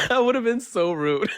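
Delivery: high voice breaking into real laughter; laughing. Real, not acted. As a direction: laugh helplessly through the words. Cracks up over nose-blowing.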